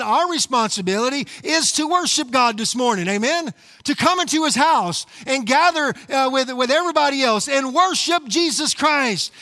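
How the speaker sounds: fiery sermon delivery; preaching. Real, not acted. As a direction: preach it out to the congregation. Sermon at full force.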